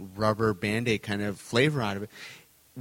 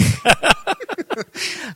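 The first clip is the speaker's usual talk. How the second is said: loud high laugh; 6 dB louder than usual. Real, not acted. Joking about leprechaun voices.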